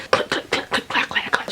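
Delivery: whispered rapid wheelchair clatter; clicking and clacking. Retold or imitated. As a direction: imitate a wheelchair knocking along in whispers. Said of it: "The clicking and clacking".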